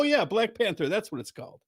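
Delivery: ordinary talk, nothing marked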